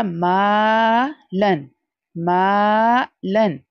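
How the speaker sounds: मालन slow, मा held long; stretched maa. Shown as a demonstration. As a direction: draw out the मा. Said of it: "Again अलिफ मद्दा". (मालन में मा लंबा)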